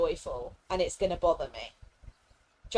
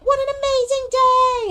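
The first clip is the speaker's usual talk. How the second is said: much higher, much louder gushing cheer; mock overjoyed Joy; 12 dB louder than usual. Streamer mocking Joy running around the valley, shrill high cheer. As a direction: burst out like a hyperactive cheerleader. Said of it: "going like".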